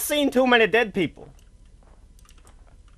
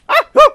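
very high, loud yelp and whoop; shriek, then whoop out the shock; startled shriek; 14 dB louder than usual. Real, not acted. Player spooked by a teddy bear, high yelps. Jump scare in horror game.

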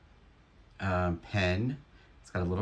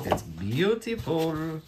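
gushing delight; 9 semitones higher, slower than usual. Real, not acted. Gushing over unsigned piece.

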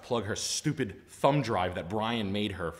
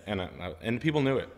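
ordinary talk

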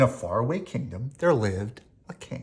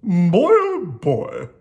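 loud, slow, higher royal lament; hammed-up storybook king's cry; 6 semitones higher, 10 dB louder, much slower than usual. A narrator's reading. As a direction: groan it out big as a fed-up king. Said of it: "cried the king".

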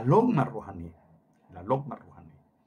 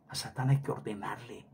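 whisper; 5 semitones lower, 9 dB quieter than usual. Real, not acted. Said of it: "sudden hush".

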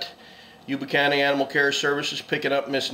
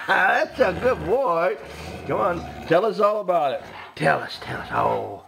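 pet talk; 4 semitones higher than usual. Real, not acted. Owner cooing at her dog Wakan, high and laughing.

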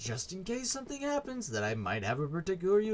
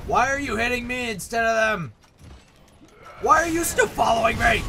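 loud, higher frustrated yell; angry yelling; 8 semitones higher, 8 dB louder than usual. Real, not acted. Yelling at the companion hitting him instead of the enemies, furious. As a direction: shout it out at the game.

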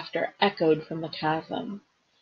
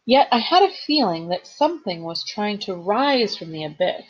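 ordinary delivery, nothing marked

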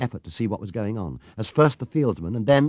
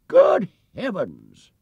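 high, slow astonished exclamation; surprised cry; 6 semitones higher, 9 dB louder, much slower than usual. A narrator's reading. Spectator in the crowd cries out in surprise. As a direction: stretch it out in amazement. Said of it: "cried someone behind her".

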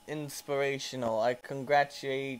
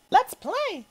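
squeaky cartoon voice; 8 dB louder than usual. Voices game character.

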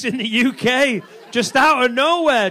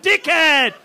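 high, loud mock yell; road-rage insult; 7 semitones higher, 6 dB louder than usual. Small-town driver to an old schoolmate who cut him off, mock angry yell. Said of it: "you honk them, they get out".

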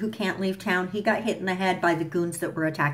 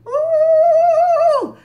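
loud, high sung "Ooh!" of glee; thrilled squeal at the reveal; excited sung ooh; 10 dB louder than usual. Real, not acted. Reacting to the Blue Devil.

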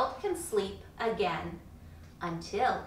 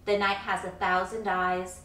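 ordinary delivery

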